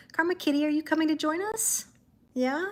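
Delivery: pet talk. Real, not acted. Calling her cat Karma over.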